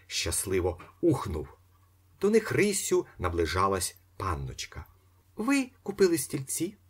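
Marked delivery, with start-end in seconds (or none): 5.4-6.8 much higher lady's voice; young lady. A narrator's reading. Young lady asking the buyers, higher voice. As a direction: ask brightly as a young woman.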